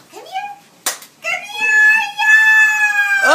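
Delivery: excited cheering. Real, not acted. Mom cheering baby crawling over.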